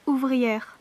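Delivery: usual speaking voice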